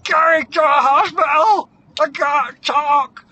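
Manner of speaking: high, loud panicked yell; hammed-up cry through a torn mouth; lipless shouting. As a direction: shout urgently, unable to form words. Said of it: "her first words are like".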